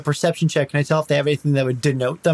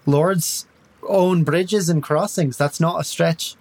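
light Irish lilt, slow and reasoning; "That's not a stretch" in a mild Irish brogue; Irish accent; 4 semitones higher, slower than usual. Acted. Tiri argues in character.

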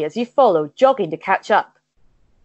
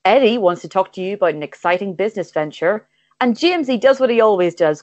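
normal talking voice

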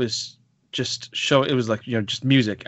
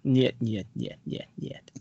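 Russian snoring; 7 dB quieter, slower than usual. Retold or imitated. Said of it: "you can probably snore with a Russian accent".

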